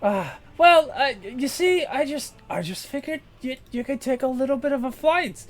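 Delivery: very high, loud shriek easing into excuses; orc scream then sheepish harpy. Acted. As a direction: scream at the betrayal, then shrug it off.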